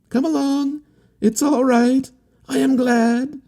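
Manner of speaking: a sunny youth waving the boat in; cheerful. A narrator's reading. Harlequin calling the steamer in, high and cheerful. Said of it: "as cheerful as you please".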